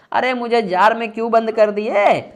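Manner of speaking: loud, high mock complaint; sulking captive. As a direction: protest theatrically at being shut in a jar. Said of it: "वो तो रूस जाएगा ना". (रूठे हुए की ऊँची, नाटकीय शिकायत)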